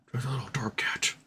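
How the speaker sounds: soft whisper to the cat. Real, not acted. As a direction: whisper it fondly.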